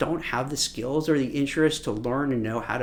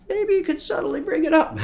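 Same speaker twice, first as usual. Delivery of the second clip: much higher, playfully delicate voice; 13 semitones higher, 4 dB louder than usual. Shown as a demonstration. Coach advising a subtle mention of hobbies, playful high voice. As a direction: lift it high as a coy suggestion. Jokey sing-song on "subtly.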